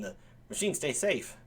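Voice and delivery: very soft aside; hushed well-wishing. Real, not acted. Host to the runaway robot, hushed well-wishing. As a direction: drop right down, as if confiding.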